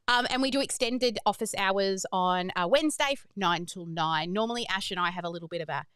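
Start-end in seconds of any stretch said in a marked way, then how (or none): none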